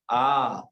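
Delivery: आ held for two beats; lengthened vowel. Shown as a demonstration. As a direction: stretch आ out long. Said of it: "थोड़ा बढ़ाया उसको". (आ को खींचकर दो मात्रा में)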